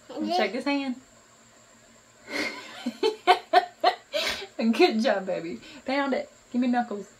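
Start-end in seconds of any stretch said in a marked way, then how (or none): 2.3-4.7 high delighted laugh. Real, not acted. Mother laughing with delight at her toddler. 4.7-5.6 laughing praise. Real, not acted. Mother laughing while praising.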